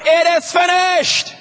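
high, slow cry, It is finished drawn out; the true cry. Acted. The real cry from the cross, high and slow. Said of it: "He cried".

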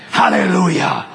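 exultant church exclamation; preacher's praise shout. Real, not acted. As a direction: call out praise from the pulpit.

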